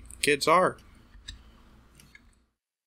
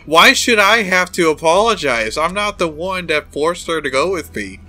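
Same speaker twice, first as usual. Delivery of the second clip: loud, higher-pitched protest; hammed-up outraged objection; acted indignation; 5 semitones higher, 6 dB louder than usual. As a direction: push back indignantly, hitting it hard. Indignant retort in game dialogue.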